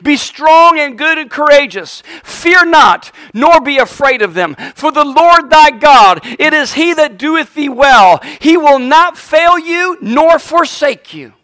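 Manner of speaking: loud, high-pitched pulpit proclamation; preaching scripture. Acted. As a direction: stretch the phrases out and proclaim them. Preaches scripture at full force.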